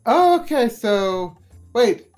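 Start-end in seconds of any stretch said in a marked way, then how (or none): none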